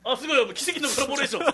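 high, loud, fast 奇跡のコラボレーション with a laugh; excited. Real, not acted. First cry of the miracle collaboration, excited. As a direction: burst out 奇跡のコラボレーション rising.

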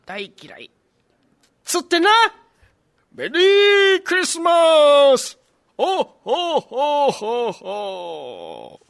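put-on creaky wise old man. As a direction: boom out a jolly ho ho ho. Santa's final Merry Christmas.

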